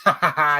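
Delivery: mock cackling ha-ha-ha; put-on mocking laughter; imitated group laughter. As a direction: fake laugh, imitating a callous crowd. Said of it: "they just start laughing".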